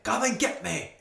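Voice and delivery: high, loud battle taunt; dwarf shouting. Acted. Dwarf taunting the chimera, loud high shout. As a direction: bellow a challenge at the beast. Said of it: "you can hear him shouting something".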